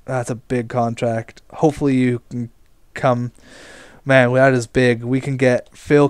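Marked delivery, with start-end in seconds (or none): none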